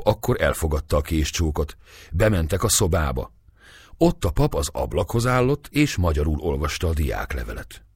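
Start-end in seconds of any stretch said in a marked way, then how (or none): none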